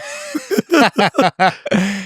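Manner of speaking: bright burst of laughter; real laugh. Real, not acted. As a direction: laugh at the memory. Laughing at own story.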